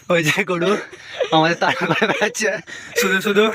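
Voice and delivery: laughing while talking. Real, not acted. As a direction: chuckle through the words. Laughing about chasing cow.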